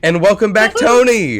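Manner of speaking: excited welcome. Real, not acted. Hyped welcome to returning player.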